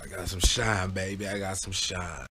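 low, lightly voiced tipsy cousin; a hint of the drunk cousin; mellow Flip. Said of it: "He said".

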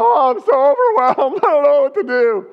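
high, whining mock panic; whiny overwhelmed. Overwhelmed believer mocked by the preacher, high and whiny. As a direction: play the overwhelmed believer, whimpering. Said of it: "they go".

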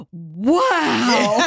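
gasp, then drawn-out wonder; awestruck wow. Retold or imitated. Uncle seeing big ice cream sundae come out, hammed-up awe. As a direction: imitate an old man amazed like a child. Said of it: "and he goes".

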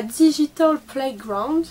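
put-on slow, loud, high English accent; over-the-top English accent. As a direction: ham up the English accent, stretched out. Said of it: "avec un accent de ouf". (accent anglais exagéré, lent et appuyé)

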